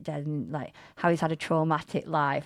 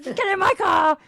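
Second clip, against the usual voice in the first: put-on Scouse accent; 13 semitones higher, 8 dB louder than usual. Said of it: "I wanna do a Scouse".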